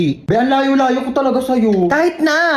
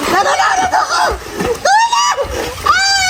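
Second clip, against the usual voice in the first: panicked cry for help. Acted. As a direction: scream like she's drowning, played for laughs. Non-swimmer panics in deep water.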